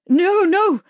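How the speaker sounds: very high, loud terrified no; panicked cry. A narrator's reading. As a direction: scream it in panic. Murray about to crush glasses.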